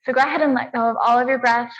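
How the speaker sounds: normal talking voice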